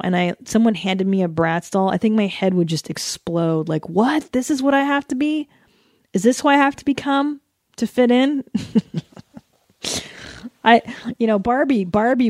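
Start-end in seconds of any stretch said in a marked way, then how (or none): 3.9-8.4 put-on high, incredulous little-girl voice; shocked little girl. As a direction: play a startled young girl.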